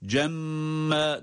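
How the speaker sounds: "Cemme" with a long humming double m; held doubled M. Shown as a demonstration. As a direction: hold the m in "Cemme" before releasing the vowel. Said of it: "tutmayı gerçekleştiriyoruz".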